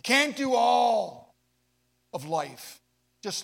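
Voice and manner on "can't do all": slow, loud shout from the pulpit; drawn-out sermon shout; preaching shout. Acted. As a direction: belt it out, dragging each word. Preacher shouts emphatic line.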